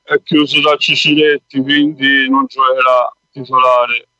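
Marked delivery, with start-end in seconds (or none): none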